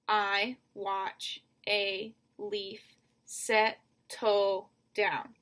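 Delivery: very slow, each syllable of "I watch a leaf settle down" set apart; "settle" split in two, all words spaced wide. Shown as a demonstration. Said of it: "So seven syllables".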